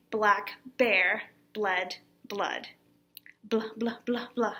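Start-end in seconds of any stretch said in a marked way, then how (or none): 3.5-4.5 short, separate "Blah" syllables, evenly spaced; repeated bl sounds. Shown as a demonstration. Teacher showing the twister's main sound, repeated bl. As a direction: bounce out each "blah" on its bl. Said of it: "That's really what we're hearing".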